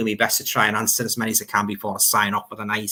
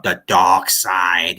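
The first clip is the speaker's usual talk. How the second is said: sinister voice; 7 semitones lower, much slower than usual. Retold or imitated. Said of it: "in a sinister voice".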